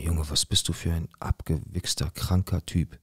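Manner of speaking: soft, disgusted aside to oneself; retold thought, voiced under the breath; inner thought. Eigene Gedanken über den Stalker. (leise gedachte Abscheu)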